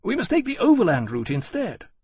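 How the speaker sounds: high, loud insistence; emphatic, urging officer's voice; insistent. A narrator's reading. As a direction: press the plan hard in argument. Granby insists on his plan.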